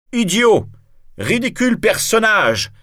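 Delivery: high, loud enraged insult; furious shout. A narrator's reading. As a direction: hurl the insult in a rage. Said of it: "crie Valvert, fou de colère".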